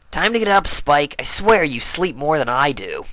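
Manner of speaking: brash and teasing; Rainbow Dash voice. Acted.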